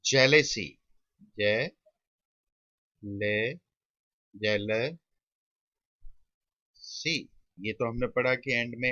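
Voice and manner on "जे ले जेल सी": isolated syllables with long pauses; slow sounding-out. Shown as a demonstration. Teacher writing "jealousy" for students, slow sounding-out. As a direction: leave a gap after each syllable.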